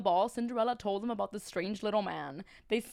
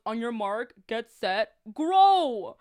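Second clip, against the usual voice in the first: race starter call; 9 dB louder, slower than usual. Acted. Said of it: "He goes".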